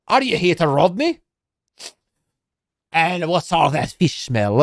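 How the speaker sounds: put-on gruff dwarf in a hammy Scottish accent; dwarf on the plain, suspicious of the stranger, bad Scottish accent. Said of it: "that's a bad Scottish accent".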